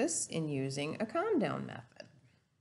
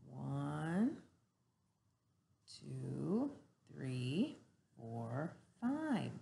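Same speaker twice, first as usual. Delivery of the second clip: softer, slow counting, fading down; 3 semitones lower, 7 dB quieter, much slower than usual. Shown as a demonstration. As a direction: count gently, calming them down. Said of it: "count to five very slowly".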